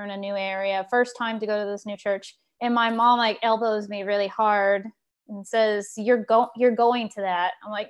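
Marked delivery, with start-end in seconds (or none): none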